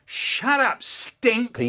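high-pitched exploding insult; angry outburst. Acted. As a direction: burst out after a hushed build-up. Angry outburst after hushed build-up.

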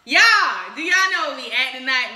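loud, high holler to the audience; big rowdy "y'all"; excited call-out. Real, not acted. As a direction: call out to the crowd, hyped. Calling out to the audience.

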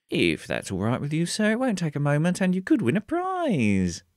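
high, eager survey-taker ghoul; chirpy ghoul doing a survey. Acted. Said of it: "said the ghoul".

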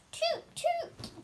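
high toot toot like a train whistle. Acted. Child reading to brother, high train toot.

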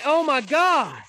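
high, loud demanding cry; loud demand to a ghost; frightened shout. Real, not acted. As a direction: yell at the spirit to show itself. Shouting at a spirit.

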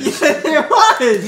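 slow loud laughing insistence; laughing shout. Real, not acted. Heated playful argument over cards.

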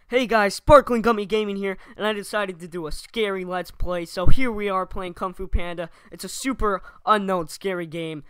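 loud, pumped-up gamer streamer voice; hyped YouTuber intro. Pretend. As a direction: perform a cheesy Let's Play intro. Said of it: "I did a little demo reel for you guys".

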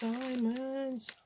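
high thrilled coo over the gems; delighted squeal. Real, not acted. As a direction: squeal it like treasure found. Repeats the word, second time squeals.

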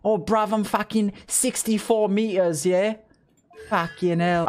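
slow, put-on British grumble; British accent. Shown as a demonstration. Streamer demonstrates the dashing accent, drawn-out mock British. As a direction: play a British gamer exclaiming. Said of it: "your accent changes".

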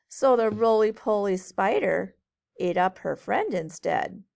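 lilting storybook read-aloud; reading to children. A narrator's reading. Teacher reading a rhyming book to kids, lilting. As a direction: read it brightly to a group of kids.

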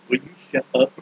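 a hint of a low, hushed God; God losing patience. God to the apologizer about angry Jonah, lightly voiced, low exasperation. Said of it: "God's like".

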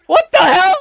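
high, loud astonished outburst; shocked. Real, not acted. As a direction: blurt it in real shock. Shocked by fight on screen.

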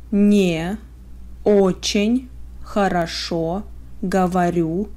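ordinary talk, nothing marked

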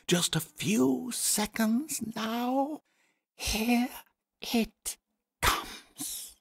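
awed whisper of anticipation; hushed suspense. Acted. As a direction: breathe it out as the moment nears. Narrator builds hushed suspense.

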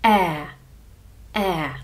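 е like e in the end, open; demonstrated vowel. Shown as a demonstration. Teacher shows the vowel е. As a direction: say е short and open. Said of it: "the next vowel is".

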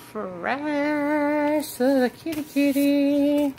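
cooing. Pretend. Cooing at kitten.

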